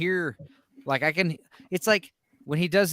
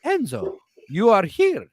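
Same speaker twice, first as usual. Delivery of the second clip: put-on old Italian granny accent; imitating a confused nonna; Italian grandmother; slower than usual. Grandmother greeting Enzo from the living room, in old Italian accent. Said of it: "she'd be like".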